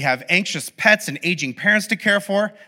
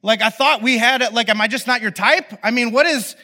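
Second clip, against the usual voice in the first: worked-up, higher-pitched mock desperation; paranoid; 6 semitones higher than usual. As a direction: demand answers like an insecure, rejected friend. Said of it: "I start getting, like, paranoid".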